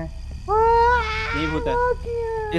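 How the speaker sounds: spooky ghost voice. Pretend. Teasing friends about a ghost.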